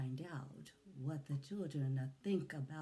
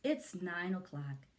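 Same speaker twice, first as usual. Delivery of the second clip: ordinary delivery, nothing marked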